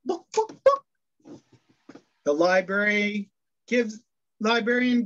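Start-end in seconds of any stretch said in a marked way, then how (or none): 0.0-0.8 high clucking chicken voice, book as a cluck. Acted. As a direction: cluck the words like a hen. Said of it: "The chicken says".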